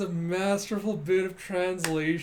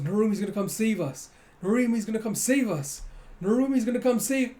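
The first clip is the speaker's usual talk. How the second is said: repeated chant rising higher and louder each time; a scared kid clinging to hope, getting louder; building panic; 7 semitones higher, 5 dB louder than usual. Retold or imitated. Boy shields his sister from the kaiju, panic building.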